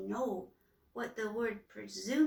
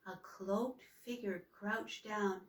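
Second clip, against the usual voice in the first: usual speaking voice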